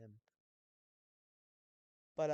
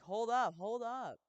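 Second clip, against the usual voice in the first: loud hold up; 7 semitones higher, 7 dB louder, slower than usual. Retold or imitated. Dad cuts off the rushing woman.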